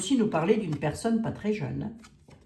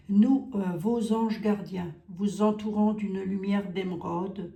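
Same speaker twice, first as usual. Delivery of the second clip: slow, solemn reading of the card message; angel card reading; 2 semitones higher, 5 dB louder, slower than usual. Acted. Vlogger reads the guardian angels' message to viewers, solemn.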